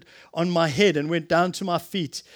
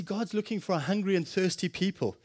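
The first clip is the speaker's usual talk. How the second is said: ordinary talk, nothing marked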